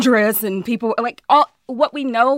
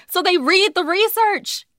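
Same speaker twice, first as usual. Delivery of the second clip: angry, rising pitch; 9 semitones higher than usual. Real, not acted. Rising anger at deniers.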